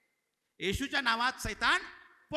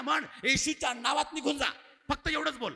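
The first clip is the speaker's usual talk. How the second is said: put-on raised, louder instruction to a child; higher, forceful retold command; retold firm command; 4 semitones higher, 4 dB louder than usual. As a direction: recount a pastor's emphatic rebuke, pitched up. Retells coaching boy to rebuke.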